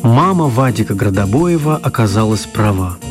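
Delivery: ordinary talk, nothing marked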